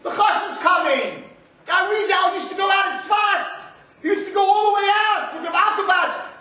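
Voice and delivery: high, loud impassioned sermon; fiery preaching. Acted. Rabbi urging the congregation to sing, full-force preaching. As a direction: build the fire of the sermon.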